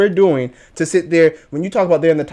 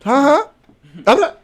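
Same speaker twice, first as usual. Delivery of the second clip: mock ref; 9 semitones higher, 4 dB louder, slower than usual. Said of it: "I'd be saying".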